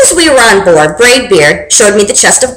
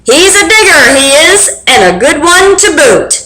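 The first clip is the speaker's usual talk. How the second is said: higher, calling to the crew; pirate captain calling out to his crew, higher; pirate yell; 6 semitones higher than usual. Acted. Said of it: "he yelled back to his crew".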